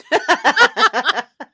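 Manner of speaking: loud, high burst of laughter; big laugh. Real, not acted. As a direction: really crack up at your own joke. Laughing at own joke.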